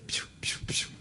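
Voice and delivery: soft whispered piu piu to call the waiter; whispered bird call. Retold or imitated. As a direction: hiss a little bird call. Chamando o garçom com psiu. (chamado sussurrado de passarinho)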